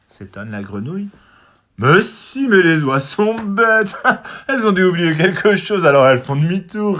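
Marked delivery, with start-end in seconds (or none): 1.8-7.0 high, loud bird with a mocking laugh; sneering. A narrator's reading. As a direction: snigger at the silly geese. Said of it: "ricane Zigomar".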